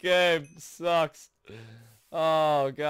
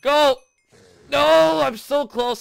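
high, loud cries easing at the end; urgent shouting; 10 semitones higher, 8 dB louder, slower than usual. Real, not acted. Player so close to winning, urgent yelling. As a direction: shout urgently, then in dismay. Urging, then failing near goal.

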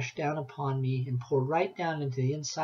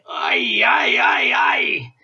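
loud, high, wailing ay ay ay; frantic wail; 3 semitones higher, 10 dB louder, much slower than usual. A narrator's reading. Granny hopping about the kitchen, wailing loud. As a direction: wail in horror like a scandalized granny. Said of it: "hopping up and down".

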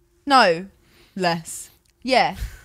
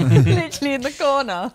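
ordinary talk

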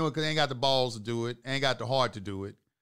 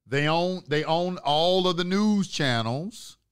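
normal talking voice

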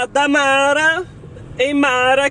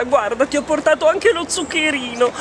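ordinary talk, nothing marked

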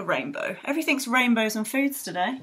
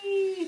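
a high, playful wee; 9 semitones higher, 5 dB quieter than usual. Pretend. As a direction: squeal a high wee as if sliding down. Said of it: "and you're gonna go".

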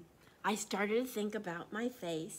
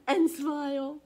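louder, swelling and smile; swelling into a louder repeat; bigger; 3 semitones higher, 9 dB louder than usual. Acted. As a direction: let the second smile grow bigger and louder. Repeated phrase builds in reading.

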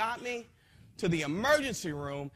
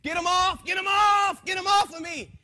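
higher, loud frantic yell to get them off; a terrified man screaming at unseen attackers; 10 semitones higher, 6 dB louder than usual. Retold or imitated. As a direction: shriek it as if fighting them off. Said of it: "And I was screaming".

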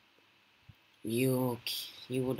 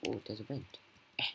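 ordinary talk, nothing marked

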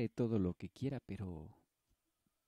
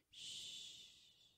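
shushing; 15 dB quieter than usual. Acted. Telling herself to be quiet.

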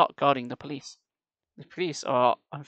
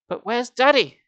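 put-on higher, anxious girl's voice; scared girl asks for her father, higher; worried girl; 8 semitones higher, 6 dB louder than usual. A narrator's reading. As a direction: voice the frightened daughter.

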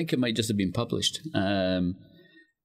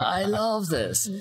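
drawn-out, laughing "I love this"; amused and charmed; laughing delight; 9 semitones higher, much slower than usual. Real, not acted. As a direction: savor it through a laugh. Delighted at the brochure idea.

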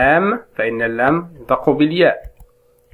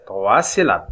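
ordinary talk

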